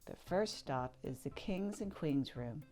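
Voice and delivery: ordinary delivery, nothing marked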